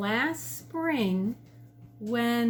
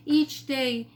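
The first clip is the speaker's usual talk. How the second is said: plain reading voice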